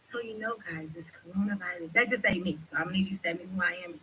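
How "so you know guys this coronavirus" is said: much softer, meek little voice; talking real little. Shown as a demonstration. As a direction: shrink it down to a timid little voice, mockingly. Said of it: "want me to talk, like, real little".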